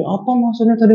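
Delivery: high-pitched mock challenge; imitated confrontational driver. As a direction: act out an affronted driver demanding an explanation. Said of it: "Pokoknya agak gini dah".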